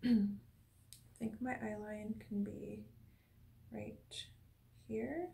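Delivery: soft whispered self-talk. Real, not acted. Actor setting up her eye line, whispering to herself. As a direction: murmur the setup under your breath. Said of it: "whispering while setting up shot".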